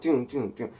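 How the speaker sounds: plain reading voice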